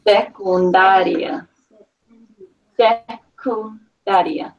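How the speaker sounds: "Secundaria" drawn out syllable by syllable; slow pronunciation model. Shown as a demonstration. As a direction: model "Secundaria" very slowly for a learner. Teacher models word for reading student.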